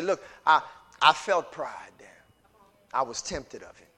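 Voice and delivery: a hint of a tender confession; quiet confession. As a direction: confide it gently. Confession to spouse.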